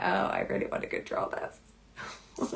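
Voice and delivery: under the breath; hushed inner admission. Acted. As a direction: murmur it as a private inner thought. Voicing a brain stuck in stickiness.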